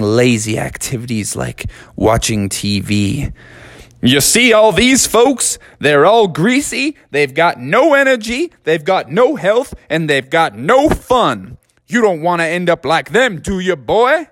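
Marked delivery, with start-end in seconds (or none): 4.0-14.3 high folksy warning voice; Colonel lecturing. Acted. Colonel warning Froggy about lazy junk food, high folksy voice. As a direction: warn the boy like a stern southern gent.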